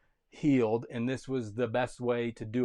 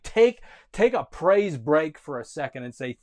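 preaching; 8 dB louder than usual. Real, not acted. Preaching at full force.

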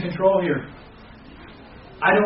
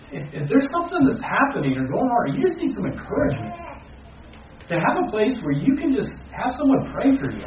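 high, pressing pastoral plea; heartfelt high plea; earnest pleading; 8 semitones higher than usual. Real, not acted. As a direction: urge them to come for prayer.

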